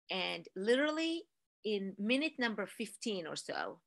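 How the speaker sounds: ordinary talk, nothing marked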